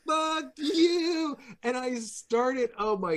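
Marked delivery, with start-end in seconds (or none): 0.1-1.4 high mocking shout of fuck you; bratty kid yelling from a limo; imitated taunting yell. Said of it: "he's going like".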